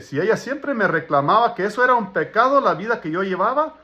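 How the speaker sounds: normal talking voice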